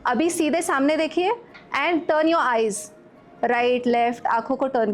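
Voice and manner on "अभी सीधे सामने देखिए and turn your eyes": ordinary talk, nothing marked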